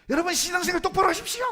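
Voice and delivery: high, loud, fast pretend outburst; mock angry scolding. Preacher pretending to scold his congregation, mock anger. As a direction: suddenly yell at the congregation as if furious. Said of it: "화를 냈다 칩시다".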